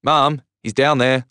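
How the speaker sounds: drawn-out mild yell; yelled call. A narrator's reading. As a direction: call out to Mum from the door. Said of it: "when I'd get home I'd yell".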